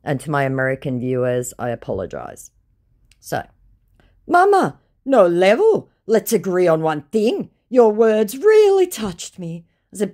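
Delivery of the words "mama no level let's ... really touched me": high, pleading mock voice of the scammer. Reading the scammer's message aloud in a high mock voice. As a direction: play the whiny scammer, pitched up.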